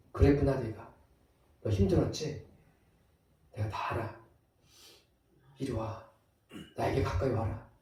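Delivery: soft, hushed, consoling invitation; tender comforting. Acted. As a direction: comfort a hurting friend, very softly. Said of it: "오히려 위로하세요". (아주 부드럽고 조용하게 위로)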